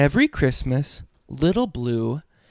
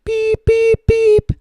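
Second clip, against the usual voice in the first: very high piping beep beep beep; truck horn beep; 4 dB louder, slower than usual. Acted. Dad voicing Little Blue's horn at the end, very high piping. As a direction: toot like a tiny truck horn. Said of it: "Little blue truck".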